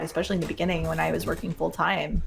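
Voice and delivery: ordinary talk, nothing marked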